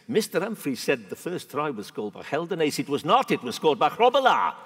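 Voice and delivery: imitating the South African ambassador's accent with loud indignation. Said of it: "I can't do the South African accent very well, but I will try".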